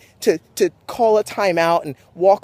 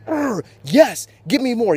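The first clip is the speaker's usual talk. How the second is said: raised growl, then hungry for more; fired up; 5 semitones higher, slower than usual. Retold or imitated. Athlete choosing to play through pain, fired up. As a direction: psych yourself up through the hit. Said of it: "that much more fired up".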